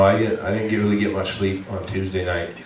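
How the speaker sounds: ordinary talk, nothing marked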